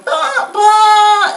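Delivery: strained high ba. Shown as a demonstration. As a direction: squeeze out the top note with strain. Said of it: "it's hard not to wanna go".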